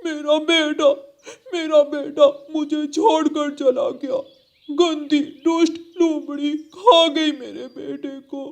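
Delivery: high, tearful father's lament; grieving wail. Acted. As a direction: wail in grief for your lost child. Father crow finds son's feathers.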